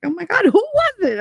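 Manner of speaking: very high excited imitation; eager prying. Friend begs to know who, high excited prying. Retold friend begging for gossip.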